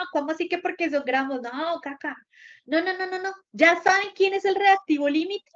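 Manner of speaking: ordinary talk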